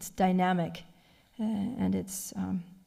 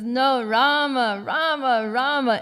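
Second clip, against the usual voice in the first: "Rama" repeated with a higher, louder punch each time; emphatic; 4 semitones higher, 6 dB louder, slower than usual. Retold or imitated. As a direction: insist lovingly, hitting every "Rama" hard.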